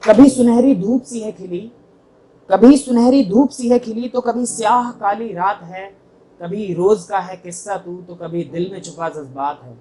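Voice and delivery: ringing openings, falling line ends; mushaira-style verse delivery; poetry recitation. Acted. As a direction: recite to an audience, measured and emphatic. Recites own poem to audience.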